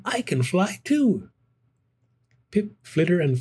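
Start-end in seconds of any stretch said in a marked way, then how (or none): 0.0-1.3 thought. A narrator's reading. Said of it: "thought Stella Luna".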